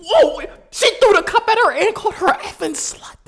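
high, loud shocked whoa; shocked yell. Real, not acted. Reacting to the cup thrown at mom, shocked high yell. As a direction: yelp it in disbelief.

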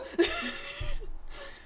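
high burst of laughter. Real, not acted. Amused by a dragon picture that looks like a dog, bursting into high laughter.

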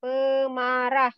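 "Pemarah" spoken slowly and clearly as a model, pe-ma-rah; slow model word. Shown as a demonstration. Teacher giving the Malay word to a student, slowly. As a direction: lay out "Pemarah" syllable by syllable for the student. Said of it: "Grumpy is what?".

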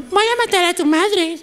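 voz aguda de muñequita; voz de muñeca. Shown as a demonstration. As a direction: chillona como muñeca de juguete. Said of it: "no habla como muñeca. Es como". (muñeca que amenaza con vocecita aguda)

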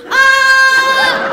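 loud high mock shriek of horror; comic scream of terror; horrified scream. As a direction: shriek at the awful thought. Horrified at garbage man future.

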